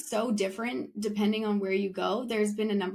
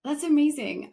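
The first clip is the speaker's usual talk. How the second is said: bright, louder burst of amazement; really impressed, let it lift; excited; 6 semitones higher, 5 dB louder than usual. Real, not acted. Host amazed at guest speaking four languages, excited.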